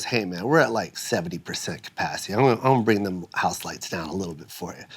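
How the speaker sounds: low, soft-spoken stage manager. Retold or imitated. Stage manager to the waiting comic backstage, low and quiet. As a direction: keep it down, confiding and practical. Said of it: "the stage manager walked up and he goes".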